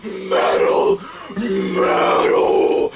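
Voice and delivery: drawn-out raspy metal roar; over-the-top death metal yell; metal scream. Pretend. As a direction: roar it like a metal vocalist, stretching each word. Mimics metal vocals in praise.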